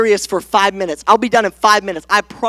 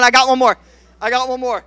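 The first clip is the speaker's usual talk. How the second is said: usual speaking voice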